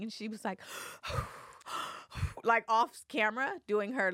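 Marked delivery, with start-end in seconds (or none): 1.7-2.4 audible nervous breathing, acted out; big breaths in and out; imitated deep inhales. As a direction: mimic calming breaths off camera. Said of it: "like, inhales".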